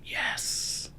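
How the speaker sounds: a whispered yes of triumph; quiet fist-pump yes; excited whisper. Retold or imitated. Told his unit is deploying, a thrilled whispered yes.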